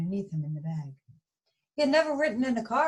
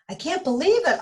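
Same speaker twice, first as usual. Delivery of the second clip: gasped; 10 semitones higher, 6 dB louder than usual. Acted. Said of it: "Arnie gasped".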